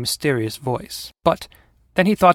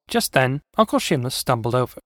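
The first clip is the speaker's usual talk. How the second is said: plain reading voice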